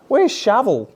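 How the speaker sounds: high, loud pretend dog call. Owner looking for an imagined dog, calling out.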